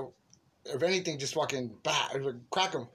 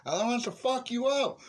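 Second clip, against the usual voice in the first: re-enacted yell; 8 semitones higher, slower than usual. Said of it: "Can you do that again?".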